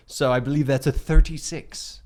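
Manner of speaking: normal talking voice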